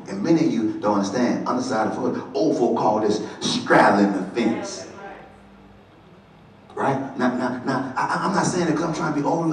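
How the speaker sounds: ordinary delivery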